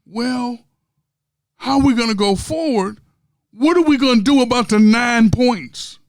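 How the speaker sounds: loud high mock commentary; mock commentator. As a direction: ask it like a baffled pundit. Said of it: "one commentator comes back and says".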